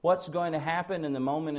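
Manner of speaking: ordinary talk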